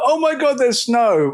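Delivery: high, excited squeal; mock snow excitement. Brits seeing snow, high mock excitement. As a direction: gasp at the snow like a giddy Brit. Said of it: "you're like".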